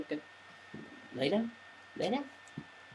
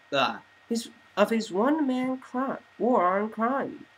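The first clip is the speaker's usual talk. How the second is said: news anchor read; 6 semitones higher than usual. Acted. TV anchors reporting on Bruce's crash, newscaster style. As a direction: deliver it like an evening news anchor.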